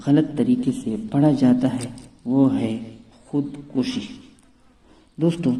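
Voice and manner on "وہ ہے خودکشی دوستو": ordinary talk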